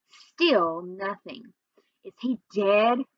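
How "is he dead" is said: ordinary delivery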